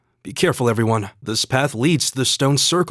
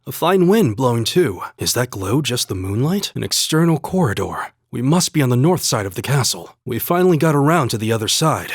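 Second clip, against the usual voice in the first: normal talking voice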